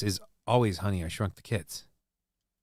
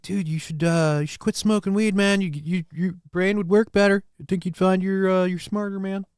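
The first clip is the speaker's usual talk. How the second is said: higher stoner-bro voice, laid back; chud impersonation; 6 semitones higher than usual. Retold or imitated. Streamer impersonating chuds who tell him to quit weed, higher bro voice. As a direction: talk like a preachy dude telling him to quit weed. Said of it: "this is the impersonation of the chuds".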